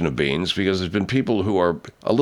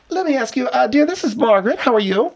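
put-on prim old lady voice; 10 semitones higher than usual. Host Margaret voice, high.

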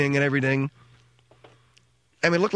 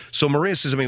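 normal talking voice

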